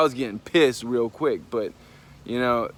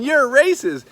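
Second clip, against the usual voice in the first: loud, high-pitched accusing mock shout; imitated yelling; 12 semitones higher, 8 dB louder than usual. Girl accusing the cashier behind the counter, imitated yelling. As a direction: fling it out loud and high, mimicking her. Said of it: "She started yelling at the girl".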